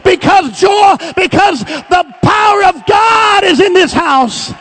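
loud, high, ringing exhortation; full-throated revival preaching; preaching at full force. Acted. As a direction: whip the congregation up into praise. Preacher exhorts congregation, shouting.

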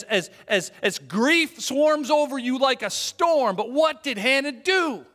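loud, high preacher's cry; impassioned preaching. Acted. Preacher describing Hannah's distress to listeners, impassioned. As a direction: pour out Hannah's grief with passion.

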